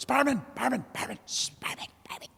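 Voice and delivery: calls fading to a whisper. Retold or imitated. Drunk squirrel calling the barman, fading into a whisper. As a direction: call out then trail off to a whisper.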